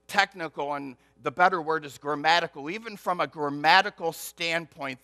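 normal talking voice

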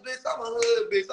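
ordinary talk

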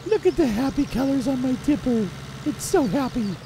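put-on high, sing-song baby talk about the tipper; cutesy high voice. Farmer cooing to viewers over his tipper, high and cutesy. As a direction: coo over the tipper like it's a pet.

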